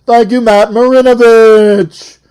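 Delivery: loud, high-pitched TV host bellow; over-the-top shouted celebrity-show host; Robin Leach impersonation. Acted. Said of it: "Robin Leach".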